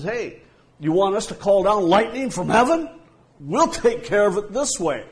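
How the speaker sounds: loud, high, brash challenge; a cocky disciple offering to call down lightning; aggressive son of thunder. Retold or imitated. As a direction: bark it out like a hothead ready to fight. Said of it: "that aggressiveness".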